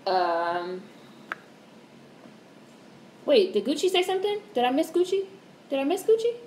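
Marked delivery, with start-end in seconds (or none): 3.2-6.4 higher, startled checking; suddenly worried she missed someone; surprised. Real, not acted. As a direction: realizes she skipped a viewer's comment, surprised. Surprised about missed comment.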